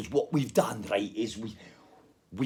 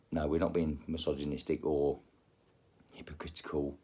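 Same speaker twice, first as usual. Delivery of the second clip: whisper; 9 semitones lower, 8 dB quieter than usual. Real, not acted. Whispered disclaimer.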